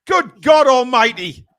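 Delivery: slow, high, loud exasperation; exasperated. Real, not acted. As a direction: drag it out in disbelief. Exasperation after the penalty call.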